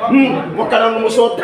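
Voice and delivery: playful laughing warning; laughing tease. Real, not acted. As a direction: wag a finger at him through laughter. Teasing the man for dodging.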